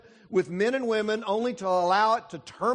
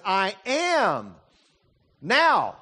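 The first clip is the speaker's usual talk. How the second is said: "now" held apart and pushed louder; stress on now; 5 dB louder, much slower than usual. Shown as a demonstration. Preacher showing his congregation God's present tense, stressing now. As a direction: hit "now" after a pause. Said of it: "the emphasis here is on the present tense".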